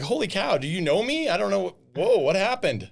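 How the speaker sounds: high, loud incredulous protest; taken aback. Retold or imitated. Roofer answering a lady who cursed him, taken aback. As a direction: act bowled over, voice jumping up.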